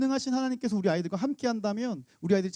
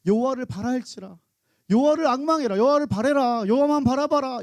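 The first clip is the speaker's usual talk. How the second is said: louder, higher proclamation; preaching; 5 semitones higher, 4 dB louder than usual. Acted. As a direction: thunder out the psalm. Preacher reciting psalm message. (시편 말씀을 높고 크게 선포)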